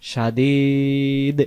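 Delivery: সাদিদ with a very long ee, stopping on d; pausal ending. Shown as a demonstration. Teacher showing the pause form, সাদিদ stretched to a final d. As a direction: drop the un and hold the ee of সাদিদ. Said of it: "দুই পেশ আর উচ্চারণ করব না".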